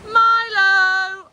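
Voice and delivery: loud high call to a dog. Real, not acted. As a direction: call his name across the beach. Calling dog on the beach.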